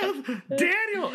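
very high, overjoyed name calls; hammed-up joyful cry. Reunited movie lovers calling each other, hammed-up joy.

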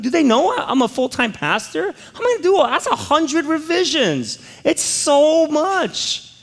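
high, pleading rush of panic; panicked disbelief. Retold or imitated. Pastor telling his congregation his panic over a hundred revisions, high and pleading. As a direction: squeak out the overwhelm, slowed at the end.

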